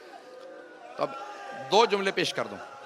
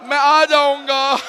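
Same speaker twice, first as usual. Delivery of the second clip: high, loud and slow wail; slow loud lament; drawn-out mournful cry; 7 semitones higher, 9 dB louder, slower than usual. Real, not acted. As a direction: stretch it into a lament.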